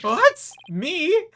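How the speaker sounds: very high, startled yelp; shocked squeak. Acted. Apollo to the princess, squeaking in shock. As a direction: jump up in pitch, caught off guard.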